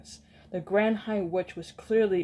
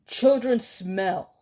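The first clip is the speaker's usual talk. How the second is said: slow, loud disgusted cry; disgusted scream; 2 semitones higher, 6 dB louder, slower than usual. Acted. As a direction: sneer it out slowly. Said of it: "she screamed".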